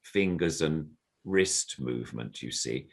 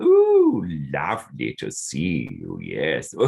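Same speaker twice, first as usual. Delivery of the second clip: puppet greeting; 4 semitones lower, 6 dB louder, slower than usual. Shown as a demonstration. Puppeteer voicing the puppet greeting the audience. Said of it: "sort of".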